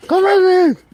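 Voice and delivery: high, loud call, name stretched out; insistent high shout; shouted dog call. Real, not acted. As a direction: holler for the dog to come.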